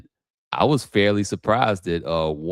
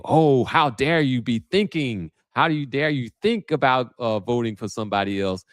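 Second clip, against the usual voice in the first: mock scolding Obama; 7 semitones higher than usual. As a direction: mimic a scolding politician. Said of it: "the emphasis was really on think".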